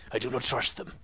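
put-on voice for reading a note aloud; character voice. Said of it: "I need to do this in a voice".

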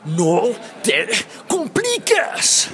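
slow caveman yell, pitch leaping up; caveman shout. Shown as a demonstration. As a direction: roar it like a caveman. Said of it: "toma uno del grito de no te compliques". (grito de cavernícola)

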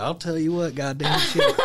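mock-drunk Southern cussing, laughing; tipsy Southern accent. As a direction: play up a good-ol'-boy Southern accent. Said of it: "we'll just drink more of this".

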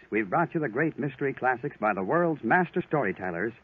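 normal talking voice

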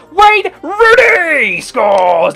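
high, loud, drawn-out goal scream; screaming celebration. Real, not acted. Gamer screaming as Rooney scores. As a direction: hold the name in a long roar.

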